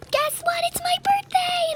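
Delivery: very high, squeaky mimic voice; mocking girl imitation. Girl announcing her birthday in the game, squeaky mock imitation.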